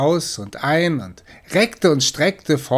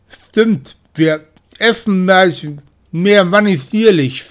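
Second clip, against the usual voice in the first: much higher and louder mock-grand; pompous agreement; 7 semitones higher, 8 dB louder, slower than usual. Kitten agreeing pompously with brother, loud and high. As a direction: puff up as you say it.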